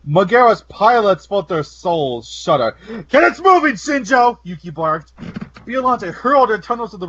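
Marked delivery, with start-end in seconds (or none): 3.1-4.4 shouting. Acted. Pilot commander yelling order.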